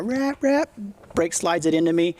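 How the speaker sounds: normal talking voice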